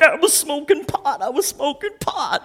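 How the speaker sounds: high, aghast repeated wail; mock horror at his own sin; horrified confession. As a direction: golfer blurting out he smoked pot, high and aghast. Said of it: "He's like".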